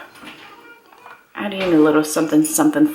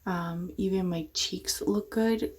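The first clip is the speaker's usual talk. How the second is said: whispering; 12 dB quieter than usual. Real, not acted. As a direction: keep it to a soft whisper. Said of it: "I'm whispering because, my son woke up".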